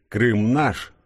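understated triumphant chant; mild crowd shout. A narrator's reading. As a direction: lightly voice a cheering crowd's cry. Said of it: "кричащих".

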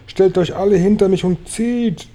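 high-pitched urgent order; shouted command. A narrator's reading. Grasshopper lines everyone up to haul, urgent high shout. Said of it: "schrie Grashüpfer".

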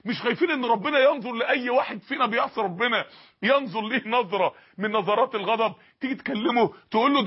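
ordinary talk, nothing marked

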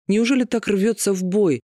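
normal talking voice

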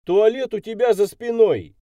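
loud, high-pitched call; shout. A narrator's reading. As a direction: shout directions across the yard. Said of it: "крикнул я".

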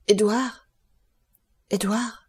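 mildly fearful calling; stammered call. A narrator's reading. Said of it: "balbutia-t-il".

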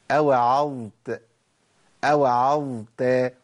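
أوعظت slowly, letting the ẓ flow into the t; audible ẓ before t. Shown as a demonstration. A teacher demonstrates the flowing ẓ in أوعظت. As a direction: keep the ẓ voiced in أوعظت. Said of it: "سامعين صوت الظاء؟".

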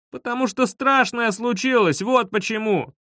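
high-pitched, exasperated panic; high, pleading protest; desperate insistence. A narrator's reading. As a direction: insist frantically through a door. Desperate argument through the door.

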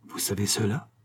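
hushed, mildly fearful question; dread. A narrator's reading. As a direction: ask softly with a touch of horror. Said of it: "le regarda avec épouvante". (question étouffée, légère épouvante)

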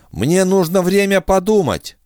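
high, otherworldly alien woman. Acted. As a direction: brave alien offering herself. Defin's alien voice held.

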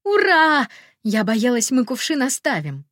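squealing with joy; high, loud happy squeal; bubbly sorority girl. A narrator's reading. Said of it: "Я завизжала от радости".

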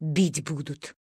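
thought to herself. A narrator's reading. Scared woman thinks to herself, soft and low. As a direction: think it in fear. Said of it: "подумала тётенька".

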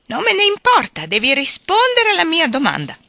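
bully her into answering; angry shout. A narrator's reading. Drunk husband shouts at his wife to answer, high and loud.

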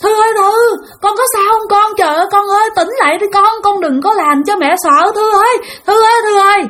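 high, frantic mother's wail; tearful screaming. Retold or imitated. Mother begging unconscious child to wake, tearful screaming. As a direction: imitate a mother crying out, pleading and high-pitched. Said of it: "nghe cái tiếng mẹ của bạn la khóc".

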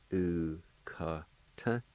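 "u, ka, ta" in separate syllables, shorter pauses; separated syllables. Shown as a demonstration. Teacher repeating the word for face, "u, ka, ta" in short separate syllables. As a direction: say "u, ka, ta" piece by piece, moving along. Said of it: "it's pronounced like this".

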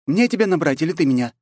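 high, pressing question; insistent. A narrator's reading. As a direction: press him for an answer. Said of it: "настойчиво проговорила Катя".

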